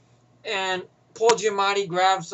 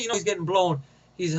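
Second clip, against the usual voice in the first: ordinary delivery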